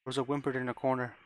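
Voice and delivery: usual speaking voice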